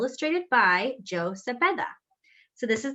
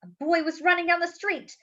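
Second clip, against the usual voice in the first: lively, expressive read-aloud storytime voice; reading to children; 7 semitones higher, 3 dB louder than usual. A narrator's reading. Teacher reading a picture book aloud to the class, lively storytelling. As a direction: bring the picture book alive for the kids.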